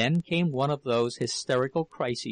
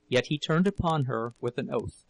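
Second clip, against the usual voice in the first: plain reading voice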